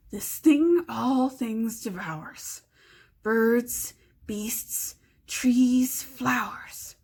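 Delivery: slow hissing riddle whisper; Gollum riddling. Acted. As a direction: draw out the riddle slowly and menacingly.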